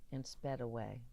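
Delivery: ordinary delivery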